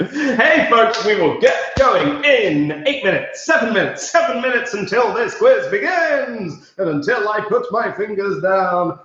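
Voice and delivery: high-pitched host calling out a countdown; rally the crowd, high and lively; excited announcement. Real, not acted. Quiz host telling folks minutes remain, excited announcement.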